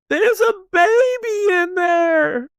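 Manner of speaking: very high, long-drawn wailing shriek; hysterical scream. Retold or imitated. Kat screams about a baby at the accident, hysterical. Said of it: "lets out the loudest scream".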